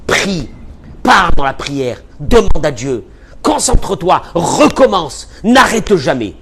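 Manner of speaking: loud, urgent sermon exhortation; loud insistent preaching; forceful preaching. Real, not acted. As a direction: drive the commands home like a preacher.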